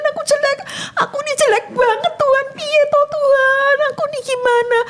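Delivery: high, pleading and despairing; a retold tearful plea to God; crying prayer. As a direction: beg God through tears. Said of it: "saya nangis".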